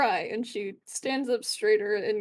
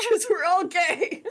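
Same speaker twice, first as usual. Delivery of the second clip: laughing it out, higher and louder; 6 semitones higher, 5 dB louder, slower than usual. Real, not acted. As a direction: echo it while cracking up.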